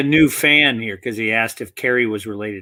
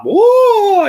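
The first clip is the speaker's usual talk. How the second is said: high squealing whoa, much higher and louder; mock Young Ones style yelp; over-the-top British comedy yelp; 9 dB louder than usual. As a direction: ham it up like a madcap sitcom. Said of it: "everybody was over the top".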